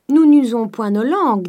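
high, loud servant's remark. A narrator's reading. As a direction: pipe up loudly and brightly as the servant. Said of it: "dit-elle en montrant ses dents".

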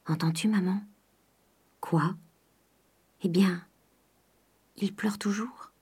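soft, hushed night-time voice; quiet, confiding near-whisper; hushed exchange. A narrator's reading. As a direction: murmur it so nobody else hears. Daughter and mother whispering at night.

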